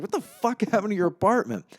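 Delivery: hammed-up indignant disbelief; Paulie going off. Paulie asking Charlie about the trashed apartment, imitated outburst. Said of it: "he just goes off".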